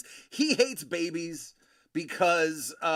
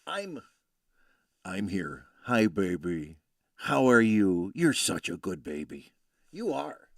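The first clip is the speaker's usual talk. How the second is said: low, resonant soothing voice; a lower, comforting voice for the baby; deep resonant baby voice; 6 semitones lower, 7 dB louder than usual. Retold or imitated. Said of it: "she hears your voice".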